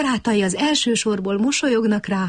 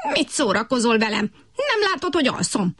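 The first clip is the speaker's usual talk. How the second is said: indignant; 3 semitones higher than usual. A narrator's reading. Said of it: "háborgott Kutykurutyki".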